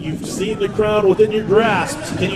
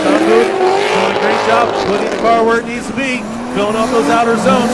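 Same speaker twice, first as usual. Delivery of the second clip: ordinary talk, nothing marked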